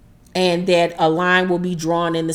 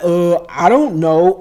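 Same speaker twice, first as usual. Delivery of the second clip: slow, louder, drawn-out hedging; hesitant mock reply; 5 dB louder than usual. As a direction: imitate someone stalling for an answer. Retold reluctant reply to questioning.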